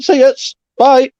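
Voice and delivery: high, bright brush-off; mock dismissive goodbye. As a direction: wave them off cheerfully. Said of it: "I'd be like".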